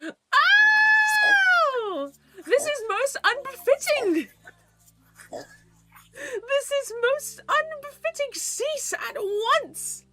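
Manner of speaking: very high mock-outraged squeal; scandalised royal. Offended royal ordering it to cease, high mock outrage.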